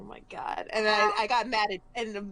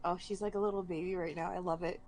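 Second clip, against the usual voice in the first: ordinary delivery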